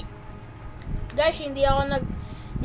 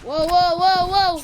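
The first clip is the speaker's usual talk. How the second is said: louder rapid wows; higher, louder hyped wows; excited wows; 5 semitones higher, 7 dB louder than usual. Real, not acted. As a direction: cheer at the play. Gameplay excitement.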